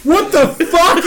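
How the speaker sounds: high, incredulous mock outburst; retold disbelief. Manager hearing the pork question, mock incredulous outburst. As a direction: imitate a stunned manager reacting.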